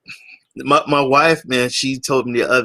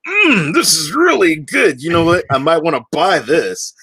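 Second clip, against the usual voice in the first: higher, louder delighted discovery; impressed sample taster; 5 semitones higher, 4 dB louder than usual. Acted. Shopper trying a free sample, pleasantly impressed. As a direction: savour the mm, then talk yourself into buying.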